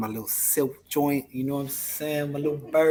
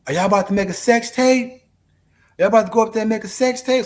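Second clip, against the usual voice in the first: imitating Zadia; 8 semitones higher, 8 dB louder, faster than usual. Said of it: "hear Zadia say".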